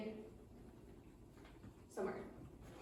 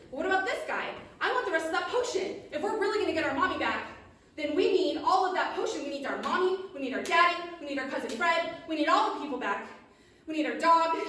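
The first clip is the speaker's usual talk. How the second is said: raised pitch, loud and pleading; insistent demand; 6 semitones higher, 6 dB louder than usual. Acted. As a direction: press your case, building through a list. Witches demand the potion for family.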